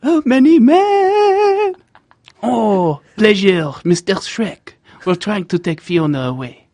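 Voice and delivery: high, slow, hammed-up French accent; mock French accent, high and showy. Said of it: "Try to do the impression".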